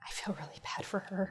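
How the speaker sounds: soft unvoiced whisper. Real, not acted. Co-player whispering sympathy while the mic is muted, soft whisper. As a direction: say it under your breath.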